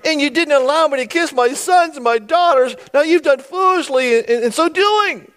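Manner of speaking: high, loud hammed-up grievance; laying it on thick. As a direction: wail the complaint theatrically. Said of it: "Is he just putting it on there thick".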